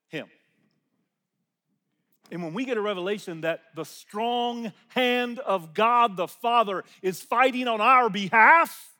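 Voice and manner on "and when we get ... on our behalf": slow and building louder; rising preaching. Real, not acted. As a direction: swell into the promise.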